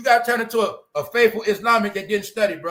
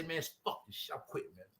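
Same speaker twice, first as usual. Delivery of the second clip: muttered quitting; 2 semitones lower, 18 dB quieter, faster than usual. Retold or imitated. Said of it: "Zion, I'd say".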